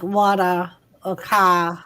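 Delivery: "water" and "car" ending in "ah"; dropped R's. Shown as a demonstration. Streamer explaining her accent, "water" and "car" with no R. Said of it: "We don't pronounce our R's".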